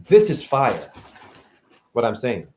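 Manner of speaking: usual speaking voice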